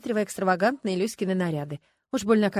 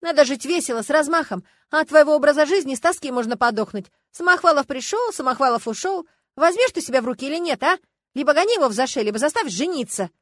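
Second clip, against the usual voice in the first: loud, high, scolding tirade; angry; 6 semitones higher, 8 dB louder than usual. A narrator's reading. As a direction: lecture a friend crossly, at full voice. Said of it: "сердито сказала подруга". (сердито отчитывая, громко и напористо)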